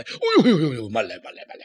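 a hint of an old lord in a panic; mock frightened stop-stop; panicked plea. A narrator's reading. Dong Zhuo stops Diaochan's sword, mock panic. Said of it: "可把董卓吓坏".

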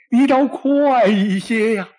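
high, loud exasperated cry; impatient shout. Acted. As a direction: yell at the messenger to hurry. Emperor impatient for Li Fu.

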